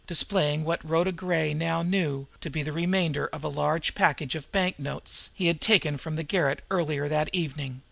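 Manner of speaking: ordinary delivery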